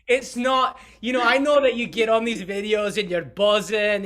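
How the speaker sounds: loud, high put-on Scottish accent. Host introducing guest in a mock Scottish accent. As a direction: bold mock Scottish brogue, hamming it up. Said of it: "I can do my Scottish accent".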